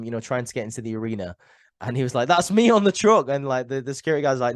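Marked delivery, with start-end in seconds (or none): 2.3-3.3 higher and louder, like a desperate man at the gate; hammed-up plea to be let in; mock pleading shout. Retelling homeless Corbin at the gate, a louder, higher mock plea.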